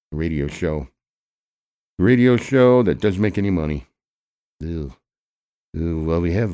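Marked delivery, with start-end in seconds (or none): 2.0-3.9 scornful jab. Acted. Mocking the show.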